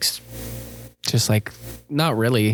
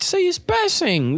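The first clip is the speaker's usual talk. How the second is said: much higher, slow whiny protest; mock whiny student; slower than usual. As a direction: whine it like a cheeky kid. Own retort in retold exchange.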